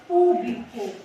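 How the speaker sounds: público with a strong first syllable PÚ; stress on PÚ. Shown as a demonstration. Teacher gives público so students find the tonic syllable, stressing PÚ. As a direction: hit the opening pú of público firmly. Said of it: "O pu".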